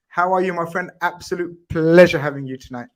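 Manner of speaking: usual speaking voice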